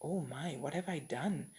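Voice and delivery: softly dawning regret; hushed realization. Acted. Lakshmana suddenly realizes his mistake.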